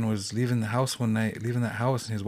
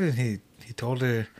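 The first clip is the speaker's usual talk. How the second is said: ordinary delivery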